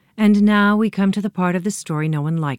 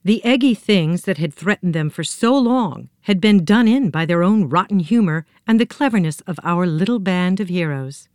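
plain reading voice

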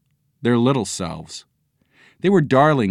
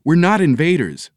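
high, earnest protest; pleading high-voiced explanation; trying to explain; 7 semitones higher, 4 dB louder than usual. A narrator's reading. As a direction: protest your innocence, pitched up. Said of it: "He tried to explain".

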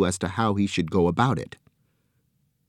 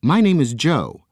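friendly Joe; 7 semitones higher, 5 dB louder than usual. A narrator's reading. Joe introduces himself warmly.

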